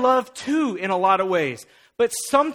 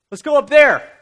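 eager call; 3 semitones higher, 8 dB louder than usual. Retold or imitated. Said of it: "he goes".

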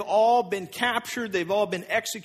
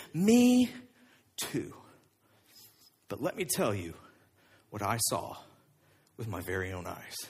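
hushed Peter; 5 dB quieter than usual. Retold or imitated. Peter would say, voiced.

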